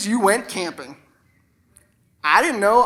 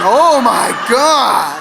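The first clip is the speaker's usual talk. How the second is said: long, loud, drawn-out exclamation; overawed; 6 dB louder, much slower than usual. Acted. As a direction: draw each word out in mock awe. Intense acted reaction to the pen.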